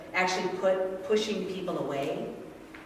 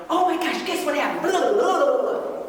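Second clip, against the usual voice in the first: put-on high, loud, bubbling child's news; excited kid; 10 semitones higher, 8 dB louder than usual. Mother voicing her child's excited race news.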